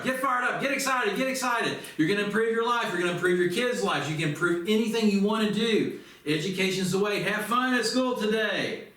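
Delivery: rally the students like a coach in the locker room; fired-up coach. Shown as a demonstration. Coach hyping his students before reading, fired up. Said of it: "as your coach, I gotta get you fired up".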